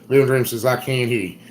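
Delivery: ordinary talk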